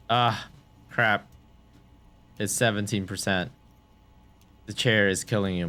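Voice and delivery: ordinary talk, nothing marked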